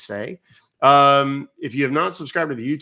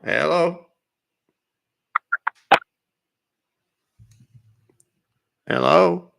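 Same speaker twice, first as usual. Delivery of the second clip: calling hello; 5 semitones higher, 5 dB louder, much slower than usual. Real, not acted. Answering a call, no reply.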